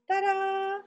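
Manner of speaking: bright ta-da reveal. Real, not acted. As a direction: show it off with a ta-da. Reveals pinned fabric.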